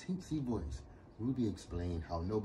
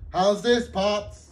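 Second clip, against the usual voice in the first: loud; 13 semitones higher, 13 dB louder, slower than usual. Acted. Ruby still too loud.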